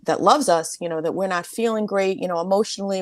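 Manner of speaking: usual speaking voice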